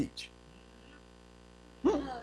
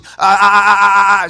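loud repeated "ah" stutter; loud, level stammer on one sound; 2 semitones higher, 12 dB louder than usual. Retold or imitated. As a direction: imitate being stuck on a syllable. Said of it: "she starts stuttering all the time".

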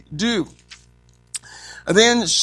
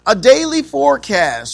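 a louder, higher call of the heading; loud announcement; 6 semitones higher, 6 dB louder than usual. Acted. Preacher calling out the heading to the congregation, loud. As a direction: announce the point to the room.

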